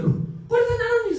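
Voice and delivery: high, loud surprised scolding, laughing; a startled laughing rebuke. Retold or imitated.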